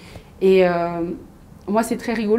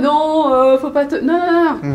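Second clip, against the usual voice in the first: high, louder mock protest; imagined disapproving mother; 6 semitones higher, 6 dB louder, slower than usual. Mother hypothetically telling daughter not to fight, mock protest. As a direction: parody a parent fussing and forbidding. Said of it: "C'était pas en mode".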